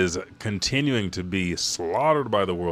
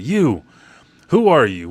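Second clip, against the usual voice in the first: much louder, higher confrontation; challenging shout; 6 semitones higher, 9 dB louder than usual. Retold or imitated. Brook challenges the stranger behind Shuri, shouting. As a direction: point the sword and shout him down.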